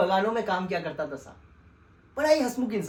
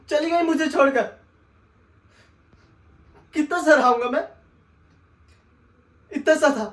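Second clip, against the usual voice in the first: louder and higher, building to a wail; anguished outcry; 3 semitones higher, 7 dB louder than usual. Acted. Telling the sahib his mother left him, anguished wail. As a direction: cry out the abandonment rising to a howl.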